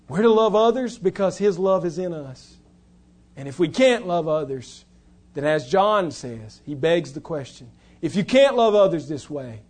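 loud, high, driving sermon voice; full-force preaching. Acted. Preacher to the congregation, loud and driving.

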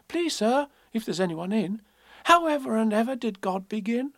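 put-on high, polite child voice; small girl. Elizabeth Ann at the great man's door, childlike plea. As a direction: ask earnestly, pitched right up.

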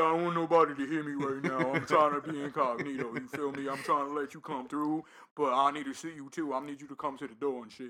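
low, hushed fake-cool man; put-on deep voice. Nervous guy disguising himself to the caller, put-on deep voice. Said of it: "he had a deep voice".

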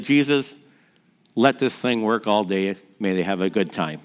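low, soft, private prayer; quiet retold prayer. Dad praying alone beside the car, quiet and low. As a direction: drop low and soft, pray simply.

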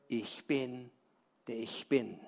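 low, hushed voice from the burning bush; low, soft divine voice; voice of God. Acted. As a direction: speak as God, deep and awed.

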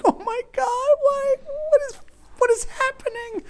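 very high, strained squeal; hammed-up helpless panic; mock despair. Dad overwhelmed by his son's antics, mock despair.

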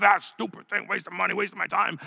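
lightly acted grumbling under the breath; cursing under breath. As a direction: mutter it like a fed-up man stomping off. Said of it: "cursing under his breath".

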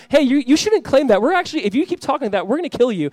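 high-pitched, agitated objection; acted warning. Voicing Jesus's threatening opponents.

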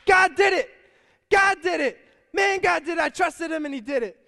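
high, loud, ecstatic cry of praise; joyful shout. Retold or imitated. Lady finding groceries on her porch, shouting for joy. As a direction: shout it out like she can't believe it. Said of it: "she's like".

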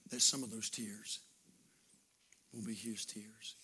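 quiet heartfelt preaching; tender hush. Acted. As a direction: draw them in gently before prayer. Soft tender closing.

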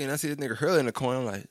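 ordinary talk, nothing marked

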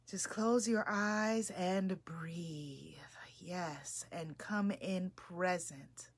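slow whispered meditation guide; soft spa whisper. Acted. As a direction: ease them into stillness in a whisper. Leads a meditation.

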